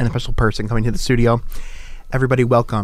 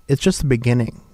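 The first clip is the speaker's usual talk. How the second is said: usual speaking voice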